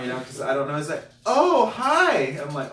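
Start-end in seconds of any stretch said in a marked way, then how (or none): 1.3-2.4 high, loud mock girl voice. Girl greeting him at the party, high imitation. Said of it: "I hear these two female".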